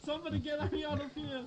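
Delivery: high, loud cry for help; man down shout. Pretend. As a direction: yell for a medic in mock panic. Mock calls for rescue in game.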